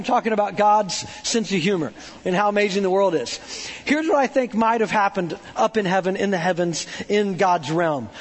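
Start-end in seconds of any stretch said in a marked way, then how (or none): none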